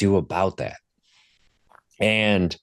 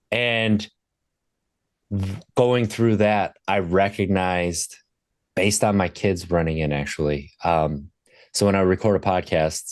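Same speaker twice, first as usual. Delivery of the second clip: usual speaking voice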